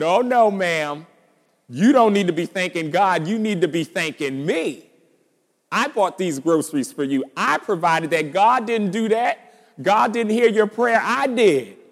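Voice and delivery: loud, high-pitched, indignant boasting; gloating rebuke. Acted. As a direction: crow over her and claim all the credit. Man gloats, claiming credit from God.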